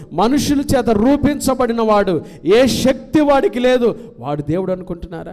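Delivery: high, loud thundering rebuke; prophet's challenge. Acted. Elijah challenging all the people, loud and high. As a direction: thunder at the wavering crowd. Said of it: "ఏలీ ఆ రోజు ప్రజలందరిని ఉద్దేశించి".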